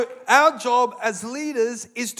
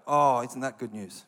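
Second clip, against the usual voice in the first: ordinary talk, nothing marked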